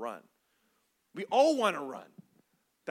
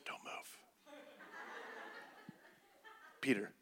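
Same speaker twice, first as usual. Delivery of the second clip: quiet; 10 semitones higher, 9 dB quieter, faster than usual. Retold or imitated. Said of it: "he kinda gets quiet".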